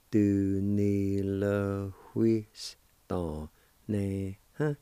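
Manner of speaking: very slow, syllable by syllable. Shown as a demonstration. Teacher drawing out the word for learners. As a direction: spell it out in sound, slowly. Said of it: "it's pronounced like this".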